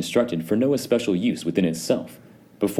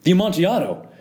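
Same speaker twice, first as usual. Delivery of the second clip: much higher, loud blurted cry; astonished exclamation; 13 semitones higher, 4 dB louder, faster than usual. A narrator's reading. Chained Fortunato crying out in astonishment. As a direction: blurt it out in shock. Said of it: "ejaculated my friend".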